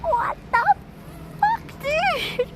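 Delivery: very high, stunned what the fuck; shocked squeal. Real, not acted. Reacting to staff waving her into the hotel bathroom, squealing in shock. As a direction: squeak it out in delighted disbelief. Laughing disbelief at being waved in.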